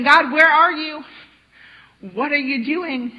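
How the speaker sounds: loud, drawn-out cry of where are you; exasperated plea. Retold or imitated. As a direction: call out to God in frustration. Psalmists complaining to God.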